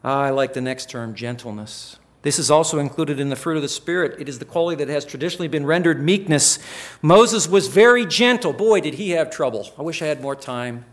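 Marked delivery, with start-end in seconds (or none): 2.2-10.8 rising preaching. Acted. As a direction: build louder and higher toward a cry. Preaching on gentleness.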